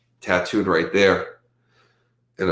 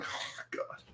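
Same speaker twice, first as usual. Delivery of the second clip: whispered groan; 23 dB quieter than usual. Retold or imitated. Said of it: "I'm like".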